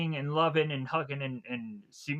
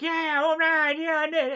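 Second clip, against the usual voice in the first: high and a bit louder, taunting; high mocking voice; 13 semitones higher, 5 dB louder than usual. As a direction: gloat like a smug thief. Thief gloating as he leaves.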